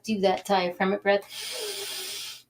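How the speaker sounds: "Do that diaphragmic breath" stretched out slowly, every word drawn long; slow it right down as they breathe; soft, slow coaching cue for "breath". Shown as a demonstration. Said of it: "Take a deep breath in through your nose".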